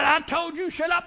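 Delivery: high, shouted mock scolding; acted scolding. Mom telling her crying child to shut up, acted shouting. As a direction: yell it like a fed-up mother. Acts out scolding mother mid-whooping.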